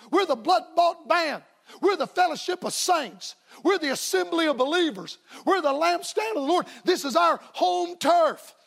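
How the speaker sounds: preaching at full force. Acted. Preacher rallying the church that this is their turf, full-force preaching.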